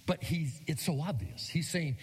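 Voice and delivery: usual speaking voice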